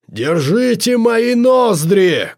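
high, drawn-out cry in a put-on voice; shouting. A narrator's reading. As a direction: cry out the order, stretching it slowly. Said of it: "кричал он".

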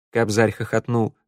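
usual speaking voice

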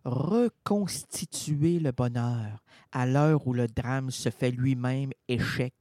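slow, low, grave reading; hushed poem read to the hall; solemn poetic recitation. Acted. Poetic spoken interlude.

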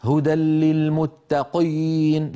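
للمتقين with the qaf pushed thick and heavy, slow; mock over-heavy qaf. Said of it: "أسمع من بعض إخواننا وخاصة في بلاد الشام".

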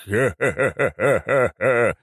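necromancer's theatrical guffaw; villainous laugh. A narrator's reading. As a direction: laugh out in separate, drawn-out ha-has. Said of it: "расхохотался Рабадон".

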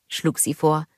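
ordinary delivery, nothing marked

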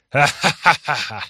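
slow, mocking villain cackle. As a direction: laugh it out with slow scorn. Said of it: "Издевательски хихикнул он". (издевательский смех)

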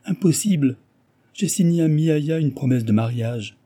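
high-pitched, woeful protest. A narrator's reading. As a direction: lament a hopeless predicament. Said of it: "Guilledou poussa un soupir lamentable". (d'un ton lamentable)

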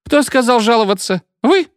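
high-pitched, indignant challenge; rising temper, sharp questioning; angered. A narrator's reading. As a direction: demand to know who dared. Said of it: "сказала фигура и рассердилась".

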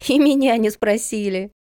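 put-on high, gleeful little boy's voice; high-pitched childish glee. A narrator's reading. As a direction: crow it merrily like a kid. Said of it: "Сенька захохотал".

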